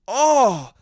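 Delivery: loud, high exclamation of disgust; re-enacted recoil. Man re-enacts smelling his own fart, loud recoiling "oh". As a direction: cry out as if hit by a stench. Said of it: "I did one of these".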